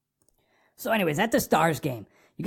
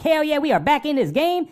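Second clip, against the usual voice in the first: high, pumped cheer; excited; 8 semitones higher than usual. Retold or imitated. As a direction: celebrate the goal. Cheering a goal.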